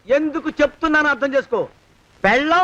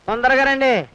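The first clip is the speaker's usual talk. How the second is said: ordinary talk, nothing marked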